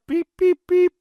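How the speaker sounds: high beeping like a backing-up truck; truck reversing beeps. Pretend. Host imitating a truck backing up, high beeps. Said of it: "back up the Brinks truck".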